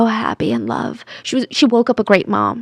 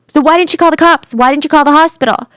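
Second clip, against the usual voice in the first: higher, insistent questioning; challenging questions; 5 semitones higher, 3 dB louder than usual. Retold or imitated. Police confronting the suspect's story, challenging. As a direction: press each question hard.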